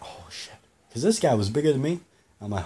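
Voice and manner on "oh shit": breathless whisper under the breath; whispered oh shit. Retold or imitated. Facing a bigger man, a frightened whisper. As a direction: whisper it in sudden fear.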